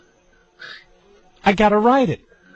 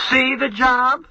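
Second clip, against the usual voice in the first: high, lofty guru pronouncement; mock guru mother; 7 semitones higher, much slower than usual. Mother playing guru to her busy son, lofty. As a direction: hand down wisdom like a guru. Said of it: "she'll start quote".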